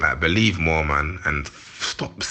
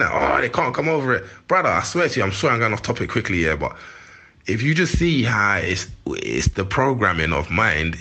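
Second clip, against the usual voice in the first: ordinary talk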